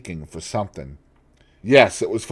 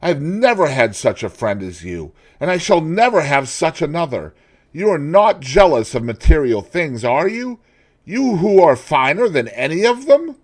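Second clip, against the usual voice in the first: mildly voiced pleading; 8 semitones higher, 7 dB louder than usual. Painter pleading.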